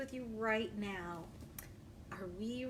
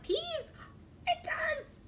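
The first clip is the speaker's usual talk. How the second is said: delighted; slower than usual. Acted. Delighted at peas on pages.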